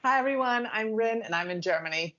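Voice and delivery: ordinary delivery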